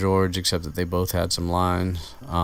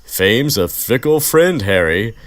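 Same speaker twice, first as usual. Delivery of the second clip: higher, louder and slow Lockhart voice; mock Lockhart; 6 semitones higher, 9 dB louder than usual. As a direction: ham up a preening Lockhart line. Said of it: "catching phrases like".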